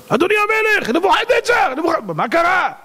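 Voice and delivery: high-pitched frantic cries to the king; alarmed shouting. Acted. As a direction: shout in astonishment, voice leaping way up. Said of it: "התחילו לצעוק".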